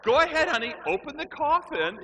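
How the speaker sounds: high, loud, strained urging; mock panicked coaxing. Scared adults send kid to the coffin, high mock panic. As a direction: coax the child on in comic terror.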